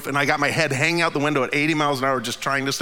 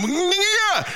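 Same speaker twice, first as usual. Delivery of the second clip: a much higher, sudden yeah as it hits him; startled yeah. Retold or imitated. Driver realizing something's wrong, surprised yeah. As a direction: caught off guard, let it jump up. Reacting to the wheel coming off.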